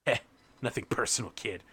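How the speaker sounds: low, soft, close-in murmur; mock ninja whisper. Cyber ninja to his victim, whispering. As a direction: murmur it coolly into the ear. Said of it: "he whispers in your ear".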